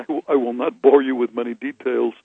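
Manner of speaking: normal talking voice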